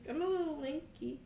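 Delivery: put-on high, loud boyish voice; a lanky kid admitting it; young Kane. Son tells his dad he's lanky, high boyish voice.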